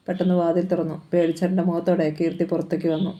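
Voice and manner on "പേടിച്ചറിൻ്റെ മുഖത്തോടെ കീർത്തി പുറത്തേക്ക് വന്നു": plain reading voice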